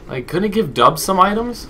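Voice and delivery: high, loud complaint; exasperated whine. Real, not acted. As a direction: whine at the game. Complaining at game.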